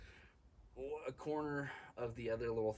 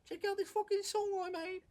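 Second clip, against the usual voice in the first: squeaky high mock British accent; hammed-up high British accent; exaggerated British accent, pitched way up; 5 dB louder than usual. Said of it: "the saving grace for this Brit".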